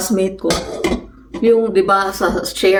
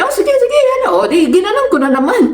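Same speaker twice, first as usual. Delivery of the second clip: put-on taunting boy; 11 semitones higher than usual. Classmate daring Marilyn again, high and mocking. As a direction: dare her again, way up high. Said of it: "Tapos sabi".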